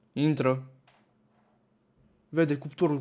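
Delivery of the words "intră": ordinary talk, nothing marked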